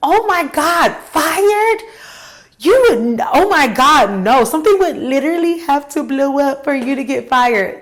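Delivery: high, loud shocked gasp of disbelief; shocked boss. Retold or imitated. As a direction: squeal it like the boss can't believe it. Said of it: "That's how she said it".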